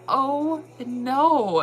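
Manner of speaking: high, loud fangirl panic; panicked excitement. Retold or imitated. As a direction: yelp it like you're losing your mind. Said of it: "I started losing my mind so bad".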